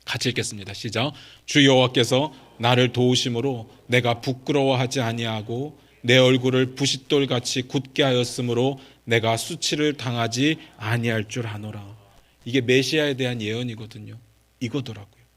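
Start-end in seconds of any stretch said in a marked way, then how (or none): 1.5-12.0 low, measured recitation on a level tune; Scripture reading aloud. Acted. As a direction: lead the congregation in reading, evenly. Leads congregational Scripture reading. (낮고 고르게 성경 봉독)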